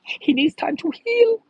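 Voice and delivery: very high, put-on whimper; high mocking baby voice; mock whiny baby. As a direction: wail it like a pampered crybaby. Mock whiny voice, repeated phrase.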